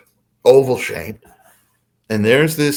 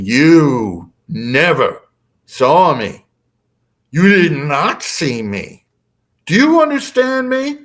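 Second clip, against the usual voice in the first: slow, forceful mantis boom; imitated alien menace, loud and drawn out; 5 semitones higher, 5 dB louder, slower than usual. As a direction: drill it in word by word, rising high at the end. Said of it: "the mantis starts booming at me".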